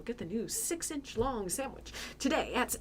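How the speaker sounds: Subway ad voice. Retold or imitated. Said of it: "I heard it on a Subway commercial".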